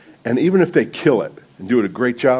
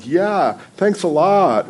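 higher, drawn-out mock gratitude; mock sarcastic thanks; 7 semitones higher, much slower than usual. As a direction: imitate her sarcastic comeback, pitched up. Retold sarcastic reply of nervous woman.

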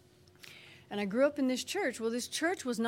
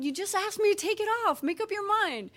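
higher, louder, fast fed-up mother; exasperated mom; 10 semitones higher, 5 dB louder than usual. Retold or imitated. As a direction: snap at the fickle toddler.

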